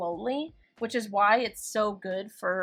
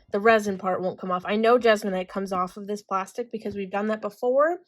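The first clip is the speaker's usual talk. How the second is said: normal talking voice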